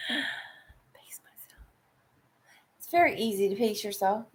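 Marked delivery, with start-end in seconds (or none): 0.1-2.9 slow breathy whisper, stretched out. Shown as a demonstration. Whispering a reminder to herself, slow and hushed. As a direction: hush it, drawing out pace.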